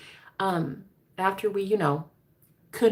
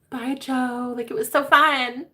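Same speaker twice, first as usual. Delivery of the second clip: higher and louder, breezy; mock breezy goodbye; 6 semitones higher, 6 dB louder, slower than usual. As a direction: wave him off carelessly. Mock farewell to Joe.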